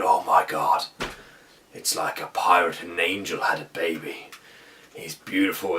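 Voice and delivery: low, soft voice of mock awe; awed admiration. Guardians admiring the unconscious Thor, in low, awed voices. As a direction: imitate hushed, low-pitched admiration. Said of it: "they just stood admiring him and just complimenting".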